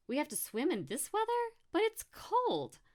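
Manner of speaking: very high whining complaint; spoilt, high-pitched protest; pouty whine. Acted. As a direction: whine it with a big pout, stretching the end. Brooke complains, lower lip out.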